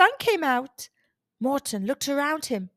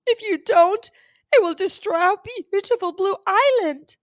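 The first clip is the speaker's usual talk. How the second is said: shaky dragon voice; 7 semitones higher, 6 dB louder than usual. Acted. Dragon begs Morton to fight the storm, shaky high plea. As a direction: plead with the boy to save the island. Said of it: "answered in a shaky voice".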